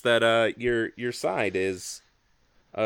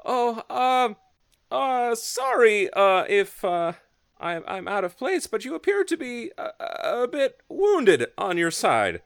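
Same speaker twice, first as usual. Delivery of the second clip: high timid healer voice; nervous, shy and high-pitched; man doing a woman's voice; 12 semitones higher, 4 dB louder than usual. Acted. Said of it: "he walks up to you and he says".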